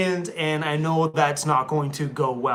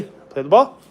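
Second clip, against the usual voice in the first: higher, louder playful coax; excited dog voice; 3 semitones higher, 7 dB louder than usual. Real, not acted. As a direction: hype the dog up to play. Playing ball with dog.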